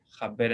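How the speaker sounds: "jaber" with a throaty j like Spanish jota, then silent-free r; Ladino jaber. Shown as a demonstration. Teacher modeling Ladino for class, "jaber" with a jota sound. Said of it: "se pronuncia diferente".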